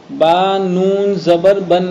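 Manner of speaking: "با نون زبر بن" spelt out slowly, chant-like; noon sakin spelling demo. Shown as a demonstration. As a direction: draw out each piece of "با نون زبر بن", landing on "بن". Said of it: "دیکھو یہ نون ساکن". ("با نون زبر بن" ٹھہر ٹھہر کر ہجے)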